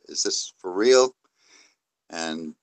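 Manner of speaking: ordinary delivery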